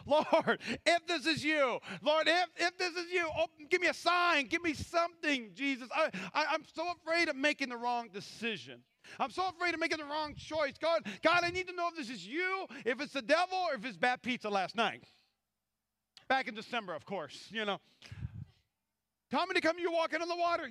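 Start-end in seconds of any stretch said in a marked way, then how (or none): 0.1-15.0 high, loud pleading prayer; anxious praying. Pretend. Pastor mimics his own anxious prayers, high pleading. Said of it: "this is half of my prayers". 19.3-20.7 high, fast call; eager Peter. Acted. Peter calls to Jesus across the water, high eager call.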